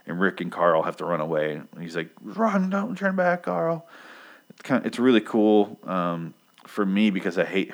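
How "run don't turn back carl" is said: high, drawn-out call without real volume; mock urgent cry. Rick fleeing the prison with Carl, mock urgent cry. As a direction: imitate a desperate father calling out, pitched way up.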